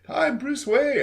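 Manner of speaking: light, high playboy charm; swanky playboy voice. Retold or imitated. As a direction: play the rich charmer, breezy. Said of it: "lighter than the Batman voice".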